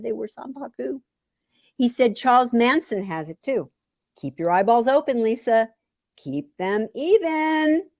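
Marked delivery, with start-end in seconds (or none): none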